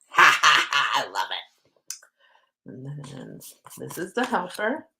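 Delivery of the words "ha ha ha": loud, punchy ha-ha-ha; real gleeful cackle; delighted laugh. Real, not acted. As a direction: burst out laughing at a welcome find. Delighted at the card pulled.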